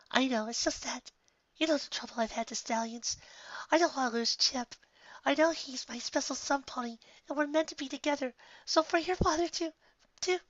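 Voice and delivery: high anxious mare voice; worried friend pleading in a high voice; Bon Bon's voice. Acted. Bon Bon worried about losing Chip.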